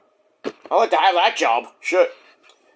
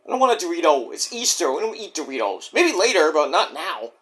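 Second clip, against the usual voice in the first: usual speaking voice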